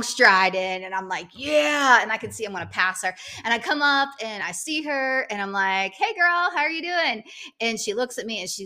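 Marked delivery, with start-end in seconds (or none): 1.3-2.0 excited yeah. Retold or imitated. Cheering while passing rival. 5.9-7.2 high, bright mock-friendly hello; sing-song hey girl to a rival; cheery greeting. Greeting rival while passing.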